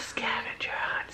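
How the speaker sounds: hushed secret teaser whisper. Pretend.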